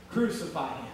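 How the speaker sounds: low, soft echo of a crowd's cry; hushed condemnation. Acted. Preacher echoes the crowd's denial, hushed. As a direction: let it drop almost to nothing.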